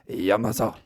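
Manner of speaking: a Japanese-sounding Yamasa, syllables even; mock Japanese accent. Shown as a demonstration. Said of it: "definitively Japanese if you make it sound like that".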